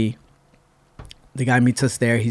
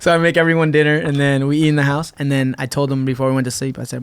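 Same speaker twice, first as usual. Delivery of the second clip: normal talking voice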